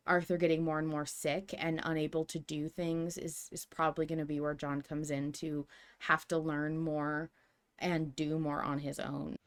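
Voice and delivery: ordinary talk, nothing marked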